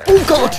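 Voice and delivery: loud terrified yelp; startled scream. Real, not acted. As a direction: scream in sudden fright. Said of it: "I'm pretty sure I screamed there".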